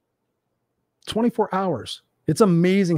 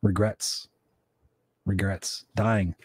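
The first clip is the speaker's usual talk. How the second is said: low dramatic repetition; 8 semitones lower, 7 dB quieter, much slower than usual. Real, not acted.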